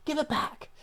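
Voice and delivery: put-on snatch it back like an indignant seven-year-old; little boy. Twin boy demands the candy back from his brother, high childish whine. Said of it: "and then goes".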